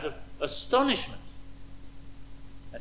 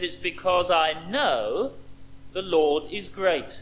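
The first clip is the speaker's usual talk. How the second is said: slow quote; slower than usual. Acted. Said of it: "He says".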